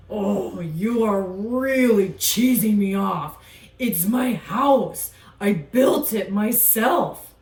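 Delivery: a gruff alligator defending himself; grumpy alligator voice. Acted.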